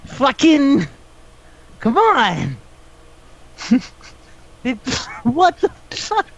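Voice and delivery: high, strained exasperation; exasperated. Real, not acted. Venting to a friend about useless kids, exasperated. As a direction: throw your hands up with your voice. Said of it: "exasperated at the kids".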